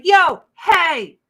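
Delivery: calling out. Pretend. Heckling a politician in the street, a loud mock call-out. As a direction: shout it across the street at her.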